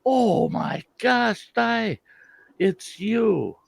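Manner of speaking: high, slow gushing squeal of recognition; mock gushing recognition. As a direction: imitate an old classmate overdoing the delight. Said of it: "They walk up and they go".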